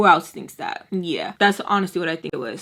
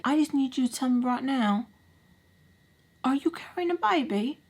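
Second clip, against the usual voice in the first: higher put-on voice with pauses; voiced stepdad; 5 semitones higher, 4 dB quieter than usual. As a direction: imitate a stepdad pressing for an answer. Voicing stepdad pressing for answer.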